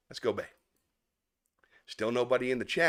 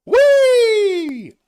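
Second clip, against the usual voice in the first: much higher, loud squeal of joy; gleeful snail; 13 dB louder than usual. Acted. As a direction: shriek it like a thrilled snail. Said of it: "What did the snail say".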